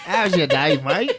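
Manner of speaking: laughing mock Australian accent; playful fake Australian accent. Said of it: "I'm done doing accents".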